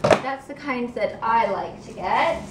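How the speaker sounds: ordinary talk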